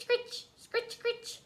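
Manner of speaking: high scratchy scritch imitation; scratching noise. A narrator's reading. Narrator imitates the scratching from the ceiling. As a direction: mimic the sound high up.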